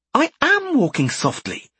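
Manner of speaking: high, loud protest, not hushed; normal volume. Acted. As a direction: say it full voice, not whispering. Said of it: "in her normal speaking volume".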